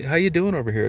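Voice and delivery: hint of a cheerful attendant checking on a gambler; chirpy casino lady.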